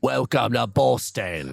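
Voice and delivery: slow, lightly put-on Boston accent; a hint of a Boston accent, slowed right down; bad Boston accent. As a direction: drawl out a fake Boston greeting. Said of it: "what I was doing that day was sort of a".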